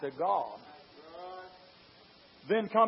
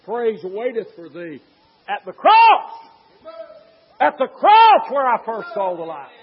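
"cross" flung out much higher and louder, building to a shout; rising preacher's cry; 4 semitones higher, 16 dB louder than usual. Acted. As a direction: start slow, then cry out "cross". Preaching climax.

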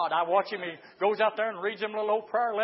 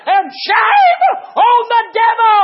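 shouted, high-pitched praise building to a long cry; full-force preaching; 11 semitones higher, 13 dB louder, slower than usual. Real, not acted. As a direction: holler it out to the congregation.

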